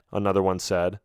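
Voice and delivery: ordinary delivery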